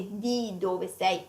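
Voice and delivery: "Di dove sei" said slowly and softly; slow model phrase. Shown as a demonstration. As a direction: model it slowly for learners to repeat. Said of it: "we say".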